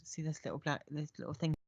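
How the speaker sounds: lightly voiced lady showing him; lady character voice. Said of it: "points at it and goes".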